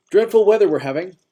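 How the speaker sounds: put-on high, loud chipper old-timer; cheerful old man. A narrator's reading. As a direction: repeat it with bright cheer. Old-timer repeats his cheerful line.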